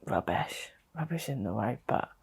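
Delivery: real grief, barely voiced; fading to a whisper. Real, not acted. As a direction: let the word drop away into a whisper. Recalling a day of tears.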